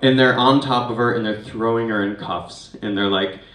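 ordinary talk, nothing marked

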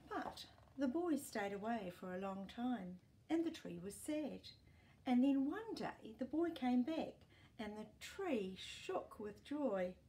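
给孩子讲故事的朗读腔; storybook reading. A narrator's reading. Reads story aloud to child.

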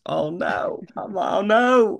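high-pitched, drawn-out mock wail; cringing dismay. Actor watching his own film, cringing in high-pitched dismay. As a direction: wince at your own performance. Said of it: "I'm going like this".

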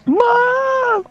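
put-on a small kid yelling for his mother; shrill repeated calls of Mom; kid calling mom. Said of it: "He's like".

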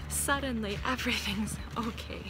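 ordinary delivery

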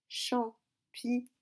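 champignon slowly, half-whispered in syllables. Shown as a demonstration. Sounding out syllables while writing. (champignon syllabe par syllabe, presque chuchoté)

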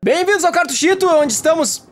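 excited greeting. Acted. Host greets viewers at intro.

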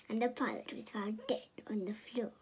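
hushed unvoiced whisper. Storyteller whispering a fake grim twist. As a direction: whisper it like a dark secret. Spooky joke before "just kidding.

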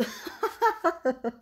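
high breathy giggle fit; helpless real laugh. Real, not acted. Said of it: "I can't control myself laughing like this".

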